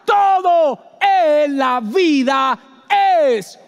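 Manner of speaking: slow, high, loud preaching shout. Real, not acted. Preacher shouting at the congregation, slow and loud. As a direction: stretch every word out at full voice.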